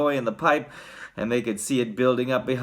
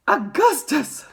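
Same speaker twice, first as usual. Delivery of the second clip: high, drawn-out shout; slow, high-pitched motherly call; Missus Gloop; 13 semitones higher, 4 dB louder, slower than usual. A narrator's reading. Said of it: "shouted Missus Gloop".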